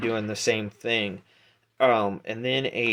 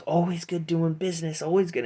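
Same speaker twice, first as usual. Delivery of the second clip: higher-voiced halfling hustler; raised, pleased dealmaker's patter; Pete; 7 semitones higher than usual. Halfling bookie collecting twenty gold, hustler's voice. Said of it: "and he goes".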